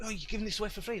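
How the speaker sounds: a stranger amazed the art is free; surprised disbelief. Retold or imitated.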